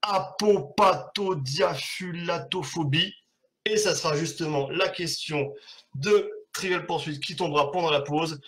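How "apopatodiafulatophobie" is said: "apopatodiafulatophobie" said slowly, syllable by syllable; careful slow spelling-out. Shown as a demonstration. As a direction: sound out "apopatodiafulatophobie" slowly and deliberately, like a tongue twister. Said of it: "c'est, attention".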